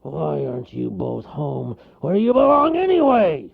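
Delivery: groaning bear, rising to a loud complaint; groan, then blow up; fed-up bear voice; exasperated groan. A narrator's reading. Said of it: "Then he groaned".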